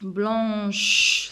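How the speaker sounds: "Blanche" drawn out to a long sh; slow "Blanche" with a hushing ch. Shown as a demonstration. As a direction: stretch "Blanche", sustaining the final sh. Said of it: "c'est H, c'est ch".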